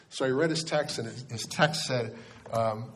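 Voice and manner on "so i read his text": ordinary delivery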